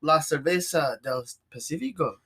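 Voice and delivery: a hint of a Spanish accent. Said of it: "saying in a Spanish accent".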